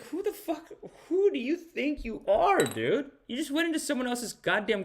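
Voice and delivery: high, incredulous telling-off; mock indignation. Comedian plays offended host to visitor, indignant. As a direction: challenge him in a high, outraged voice. Acted indignant retort to the leader.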